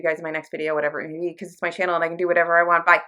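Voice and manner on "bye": ordinary talk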